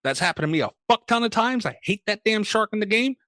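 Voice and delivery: normal talking voice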